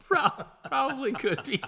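high, loud laughing reply; laughing while answering. Real, not acted.

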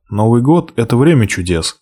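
plain reading voice

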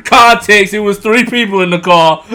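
explaining through laughter; laughing. Real, not acted. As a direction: laugh while you talk.

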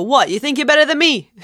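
high, fast Boston challenge; Boston accent. Shown as a demonstration. Host showing off a Boston accent to co-host, combative. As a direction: pick a fight in quick Boston accent. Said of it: "I'll put on my Boston accent".

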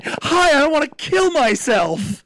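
high, slow, over-bright mock greeting; hammed-up phony happiness; fake cheer. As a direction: chirp it like a falsely happy caller. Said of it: "but you could also say".

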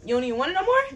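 信じられないという口調; incredulous. Real, not acted. Incredulous rebuke of ingratitude.

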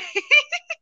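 squeaky high giggle. Real, not acted. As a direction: burst into a high laugh. Laughing at herself.

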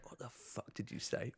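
a corporal's threat whispered right in his face; menacing whisper. Retold or imitated. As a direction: hiss it inches from his nose. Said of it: "Right in my nose".

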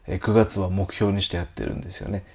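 ordinary delivery

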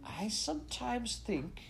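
high, soft, drawn-out musing; slow, bewildered confession. A narrator's reading. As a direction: draw it out slowly in a high, soft voice. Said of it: "he said slowly".

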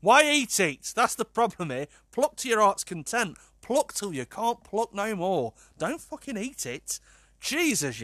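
high, incredulous telling-off; exasperated scolding. Real, not acted. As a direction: plead with her in disbelief. Scolding the absent woman.

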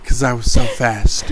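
ordinary talk, nothing marked

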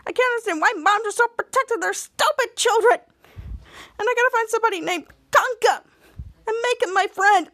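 high-pitched, distressed complaining; frantic mock rant. Ray pouring out troubles to Sandy, high frantic rant. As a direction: wail the grievances out, pitched high.

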